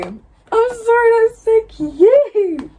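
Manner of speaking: high, loud, slow squeal; gushing squeal. Real, not acted. Fan gushing about Warner making pasta, loud squeal. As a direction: squeal over something adorable. Squealing over cute scene.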